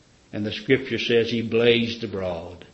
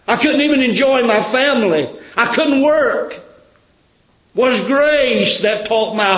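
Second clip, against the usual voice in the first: high, loud, drawn-out preaching cry; preaching at full force; 9 semitones higher, 6 dB louder than usual. Acted. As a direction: pour it out like a testimony shouted from the pulpit. Preacher voicing a convert's testimony.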